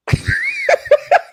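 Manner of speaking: laughing hard while telling a joke; high laugh. Real, not acted. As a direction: burst of high-pitched laughter. Laughing at his own joke.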